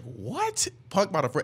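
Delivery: high, stunned, cut-off what; a hammed-up double take; mock disbelief. Retelling his reaction to the teasing, high mock disbelief.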